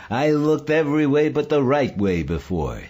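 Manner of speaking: loud, high-pitched exultant burst; elated. A narrator's reading. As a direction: blurt it out in triumph.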